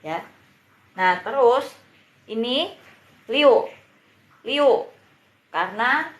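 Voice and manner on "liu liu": sharp falling fourth tone on liu. Shown as a demonstration. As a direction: snap liu down hard from high. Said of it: "jadi harus menyentak baca pinyinya".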